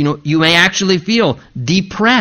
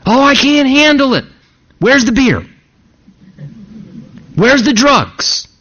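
mock whiny coping voice; 6 semitones higher than usual. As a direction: play a weak man reaching for the bottle. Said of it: "he didn't go to some dumb coping mechanism".

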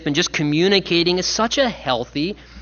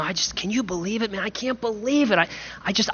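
hushed, halting lament, a little higher; dejected disciple; 4 semitones higher, 6 dB quieter, faster than usual. Retold or imitated. Disciple on the road voicing his disbelief, quiet and halting. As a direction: mumble it like a stunned, grieving follower.

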